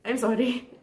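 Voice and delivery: teary laugh into a small apology; tearful apology. Real, not acted. Vlogger sorry for crying on camera, teary. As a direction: apologize through the tears. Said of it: "becoming emotional".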